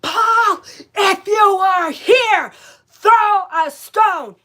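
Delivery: high, piercing full-voice scream at the ghost; slow, drawn-out shriek calling out the ghost Paul. Retold or imitated. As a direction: scream it out with everything, crying out. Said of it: "finally I scream so loud and I go".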